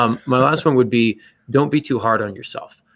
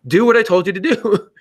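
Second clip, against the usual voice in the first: much higher, sharper voice of a scolding coach; stern coach scolding; 9 semitones higher, 3 dB louder than usual. Retold or imitated. Coach scolding a player who made excuses, in a stern high voice. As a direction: bark it like a coach fed up with excuses.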